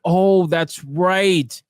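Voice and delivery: slow, drawn-out sarcastic "oh, that's right"; sarcastic realisation. Acted. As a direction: pretend it just dawned on you. Sarcastic pretend remembering.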